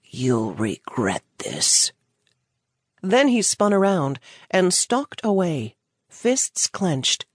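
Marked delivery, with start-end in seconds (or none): 0.0-1.9 very low, slow seething threat; low slow menace from a furious dealer; seething through teeth. A narrator's reading. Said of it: "seethed through clenched teeth".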